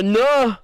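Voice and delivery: "No." with a loose open "nuh" vowel; exaggerated wrong "no". Shown as a demonstration. Streamer showing pronunciations they don't use, exaggerated. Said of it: "I don't go".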